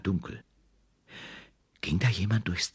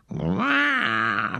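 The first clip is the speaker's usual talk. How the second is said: cub roar; 6 semitones higher than usual. A narrator's reading. The cub roaring at his mother, a high roar. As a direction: bellow it like an angry cub. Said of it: "brüllte der kleine Brüllbär".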